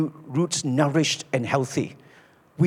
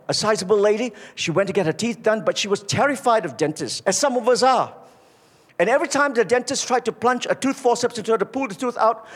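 usual speaking voice

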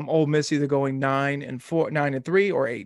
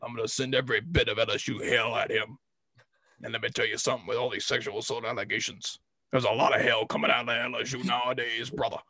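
low, soft put-on football coach voice; Coach Boyd impression; 3 semitones lower, 4 dB quieter than usual. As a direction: speak like a coach at the podium, lower and quieter. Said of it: "what I said in the Coach Boyd voice".

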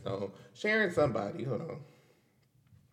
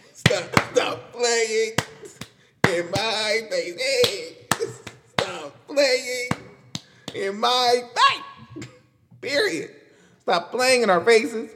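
higher, louder, drawn-out stop playing; sassy louder stop playing in my face; emphatic higher catchphrase; 5 semitones higher, 3 dB louder, slower than usual. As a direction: build the catchphrase up to a call. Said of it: "rising emphatic repeat".

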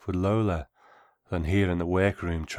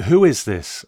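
loud, sharp, higher challenge; suspicious, raised voice; 7 semitones higher, 10 dB louder than usual. A narrator's reading. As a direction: demand it, wary and angry. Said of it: "I said, suspicious".

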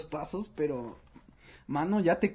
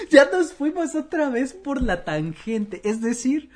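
laughing, higher; 10 semitones higher, 7 dB louder than usual. Real, not acted. Laughing at going off-topic.

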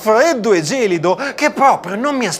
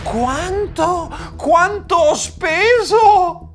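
high, drawn-out disbelief; aghast, stretched-out questioning; shocked mother; 9 semitones higher, much slower than usual. Acted. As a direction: wail the question in horror at the bill. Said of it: "dalla paura mia mamma stava quasi per svenire".

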